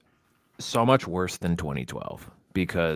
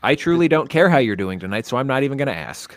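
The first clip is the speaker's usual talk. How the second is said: mock rude; 6 semitones higher, 8 dB louder than usual. Mock-rude greeting to cohost.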